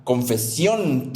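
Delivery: "confesión" with the last syllable drawn out slowly; stress on final syllable. Pretend. Imagined corrector to a growing child, "confesión" slowly stressed on its end. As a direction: stretch the accented O in "confesión". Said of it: "tilde en la O".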